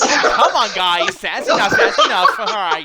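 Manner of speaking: loud laughing plea to stop; laughing shout. Real, not acted. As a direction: yell over the laughter, cracking up.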